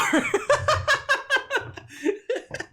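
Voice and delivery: long, high cackle; high-pitched real laugh. Real, not acted. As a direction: burst out laughing. Laughs at own rant.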